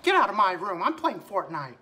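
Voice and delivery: cartoon mouse shooing a friend; squeaky mascot complaint; Mickey Mouse. Mouse sending duck friend out of room, cartoon voice. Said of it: "Mickey Mouse".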